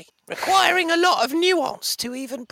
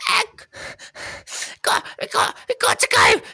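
breathless, louder panicked whisper-shout; 7 semitones higher, 5 dB louder, slower than usual. Acted. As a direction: blurt it out in a frantic rush. Kobold panics to warn them.

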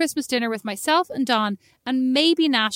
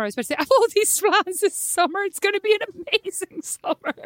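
laughing; 8 semitones higher than usual. Real, not acted. Laughs at her own slip.

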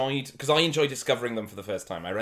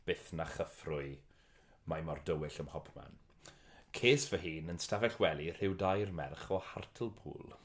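poem recital in Welsh; 6 semitones lower, 7 dB quieter than usual. Acted. Reading Welsh poem aloud.

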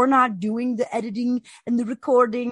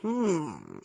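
low, soft, mock-thoughtful hum; imitating pensive streamer; 7 semitones lower, 8 dB quieter than usual. Host mimicking streamer watching trial, mock pensive. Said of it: "and it's him going".

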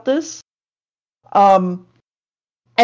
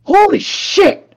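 slow, high, drawn-out "Holy shit!"; hammed-up alarm at the starfish; mock shocked cry; 10 semitones higher, slower than usual. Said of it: "he's like".

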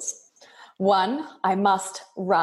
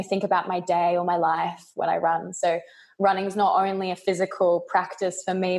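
normal talking voice

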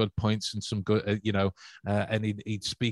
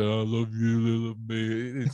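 slow, halting mock man's affection; mock men who can't say it; mock-awkward bloke; slower than usual. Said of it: "we say".

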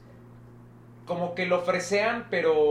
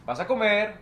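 put-on León accent; 4 semitones higher, slower than usual. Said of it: "porque es muy cantado".

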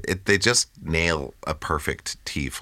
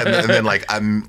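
ordinary delivery

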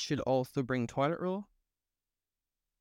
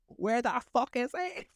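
high, slow mock outrage; a hammed-up angry demand, pitched well up; ready to pounce; 11 semitones higher, slower than usual. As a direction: spit it out like you're about to pounce on him. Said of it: "I was ready to pounce".

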